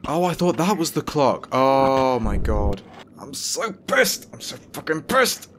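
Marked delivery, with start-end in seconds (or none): 3.2-5.5 high, slow seething I'm so pissed; fuming at the game; real anger. Real, not acted. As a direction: drag out pissed through your teeth. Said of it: "that's how pissed I am".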